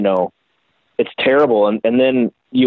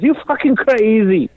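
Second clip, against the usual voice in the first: much higher, laughing taunt; high, amused Italian builder; Dario impression; 10 semitones higher than usual. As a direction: point and tease. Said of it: "he points and he laughs and he goes".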